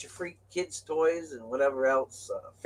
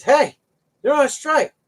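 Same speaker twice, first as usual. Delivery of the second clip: high, loud alarmed announcement; calling out; 14 semitones higher, 8 dB louder, slower than usual. Retold or imitated. Trucker phoning his boss about the strike, high and loud. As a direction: break the news loudly.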